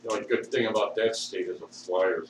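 ordinary talk

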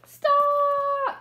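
high, loud single Stop!; shouted stop. Shown as a demonstration. Teacher shows the class how to yell stop. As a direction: belt it out for the class to copy. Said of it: "Everybody yell".

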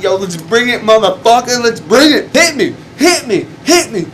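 high, loud daring yell; retold fight taunt, pumped and shouting; pumped-up challenge. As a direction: egg him on to throw a punch. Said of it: "my adrenaline was pumping".

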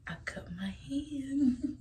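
slow hushed rehearsal whisper; slow secret rehearsal. Pretend. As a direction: whisper the line like practising. Rehearsing the fake injury whisper.

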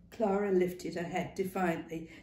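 ordinary delivery, nothing marked

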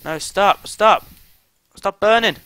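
high, loud desperate pleading; panicked shout. Real, not acted. Player pleading with the fire, panicked yelling. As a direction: shout at it to stop, rising in alarm.

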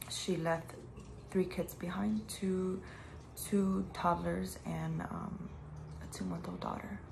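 whisper. Real, not acted. Grieving friend's death, whispering.

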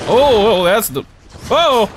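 high startled yelp. Real, not acted. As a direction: jump at the scare. Sudden attack in game.

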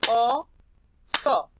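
"Oso" split loud into O-SO; clapped syllables. Shown as a demonstration. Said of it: "Aplaudan conmigo". ("Oso" separado en sílabas con palmadas)

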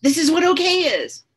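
imitating her high, awed voice; high, wondering voice of the first hit; retold high. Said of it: "And I was like".

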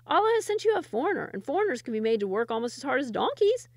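high, loud, fast scheming voice; sly villager. Acted. Villager gloats over the foreign worker, high and fast.

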